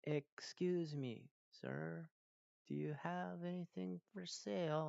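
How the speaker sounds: slow, timid in-character voice; new player nervously voicing a character to a shopkeeper. Shown as a demonstration. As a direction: try out a shy character voice. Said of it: "say it like your character would say it".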